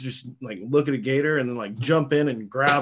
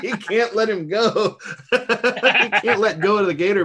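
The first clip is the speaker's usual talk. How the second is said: laughing through the words; giggling while telling it; 7 semitones higher, 3 dB louder than usual. Real, not acted.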